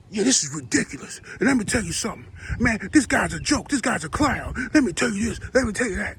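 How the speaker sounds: lightly imitating a TV host tearing into someone; mock harsh critic. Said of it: "When it's a brother, you talking like".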